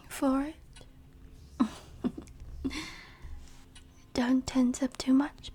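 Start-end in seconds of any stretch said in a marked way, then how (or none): 2.0-2.9 hushed vampire chuckle; soft, breath-only laugh at helpless prey; teasing chuckle. Acted. Vampire teases captive prey.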